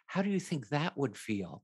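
sympathetic. Retold or imitated. Angelica reading to frightened kids, soft and sympathetic. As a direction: gently ask how it would feel. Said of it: "sort of sympathetically".

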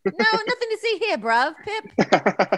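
playful fake-British patter; mock British accent. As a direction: tease with a cartoon British accent. Teasing the British speaker's accent.

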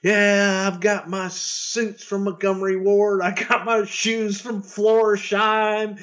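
high, slow proud brag; lightly voiced boastful wrestler; Tatanka bragging. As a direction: show off the cheap suits proudly. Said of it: "He goes".

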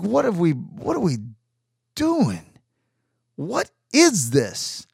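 high-pitched, baffled questioning with long gaps; exasperated disbelief. Retold or imitated. Bystander reacting to strangers screaming back and forth, exasperated. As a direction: ask it in bewildered, drawn-out dismay.

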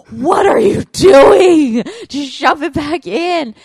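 slow, loud incredulous shout; imitated shout. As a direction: bellow it like the husband, drawn out. Husband voiced in crayon story.

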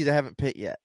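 ordinary talk, nothing marked

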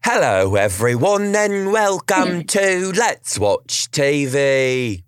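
slow, high, hammed-up British host welcome; mock British show opening; fake British accent. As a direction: stretch it out like a British TV presenter. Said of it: "we are talking like this because we just watched".

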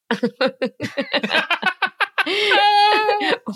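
shrieking laugh; high burst of laughter. Real, not acted. Laughing at frog study.

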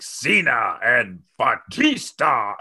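slow, drawn-out announcer hype, lightly voiced; a hint of the chairman selling big names, slowly; Vince hyping stars. Host imitating the chairman announcing returning stars, mock slow hype. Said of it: "he's like".

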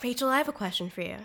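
soft, fast therapist tone. Pretend. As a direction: gently probe like a therapist. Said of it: "It's my therapist voice".

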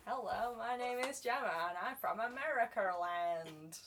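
hammed-up fake American accent; best American accent. Shown as a demonstration. Guest introducing herself, badly faked American accent. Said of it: "It's my best American accent".

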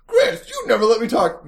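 high, laughing imitation of the cohost; a teasing take on the cohost's voice; mocking cohost impression. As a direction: mimic your cohost while laughing. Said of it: "I could do this podcast as you".

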